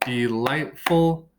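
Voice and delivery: delightful split into de-light-ful, drawn out; delightful broken into three slow, separate beats; syllable by syllable. Shown as a demonstration. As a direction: say DE-LIGHT-FUL slowly, one beat per syllable. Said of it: "broken up into three syllables".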